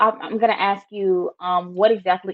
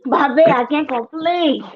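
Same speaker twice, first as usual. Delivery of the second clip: Liberian English dialogue; 3 semitones higher, 3 dB louder than usual. Shown as a demonstration. Host plays along in the demo, mock Liberian English. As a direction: greet Bobby in a hammed-up Liberian accent. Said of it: "do you wanna have a little conversation".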